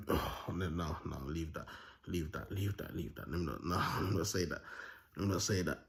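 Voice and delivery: very low, hushed mutter; muttered self-censoring. Real, not acted. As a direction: talk yourself out of it under your breath. Stops himself from saying something.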